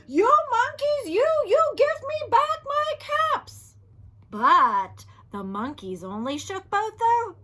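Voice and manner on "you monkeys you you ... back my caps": much higher, louder angry demand; angry peddler. Acted. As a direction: demand the caps back, angry. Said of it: "This made the peddler angry".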